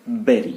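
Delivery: "Bury" as BER-ee, falling; berry pronunciation. Shown as a demonstration. Teacher repeating what he said, berry pronunciation. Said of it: "मैंने क्या कहा?".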